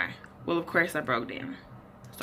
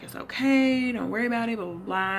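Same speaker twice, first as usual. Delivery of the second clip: higher, louder mock comfort from boyfriend; imitating boyfriend; 6 semitones higher, 4 dB louder than usual. Quoting her boyfriend comforting her, higher mock voice. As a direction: play the boyfriend brushing it off, pitched up. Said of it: "he's just like".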